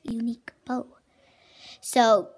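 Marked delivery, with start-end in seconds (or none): 0.0-0.9 whisper. Acted. Whispered show-and-tell opener.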